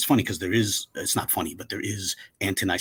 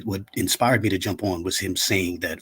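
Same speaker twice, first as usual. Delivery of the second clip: usual speaking voice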